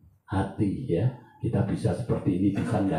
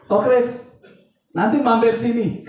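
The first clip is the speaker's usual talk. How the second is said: calling out; 14 semitones higher, 7 dB louder than usual. Retold or imitated. Caller on the phone, retold.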